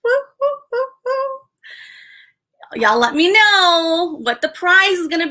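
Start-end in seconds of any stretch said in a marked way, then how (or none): 0.0-2.8 high, sing-song chant of woos; playful woo-woo. Real, not acted. Cheering a viewer on stream.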